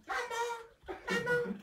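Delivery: put-on very high little-child voice; child calling mother. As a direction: pipe up to get mother's attention. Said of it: "Alors ils viennent et disent".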